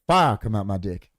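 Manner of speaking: low, punchy comic impression; stand-up routine impression, low and emphatic; Eddie Murphy. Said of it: "Do Eddie Murphy".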